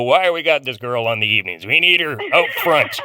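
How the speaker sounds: loud, mock-indignant clamour; imagined bosses' demand. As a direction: play impressed bosses demanding loudly, in jest. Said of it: "they're gonna go".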